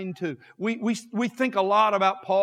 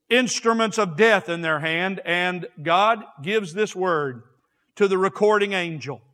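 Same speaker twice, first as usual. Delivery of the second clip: ordinary talk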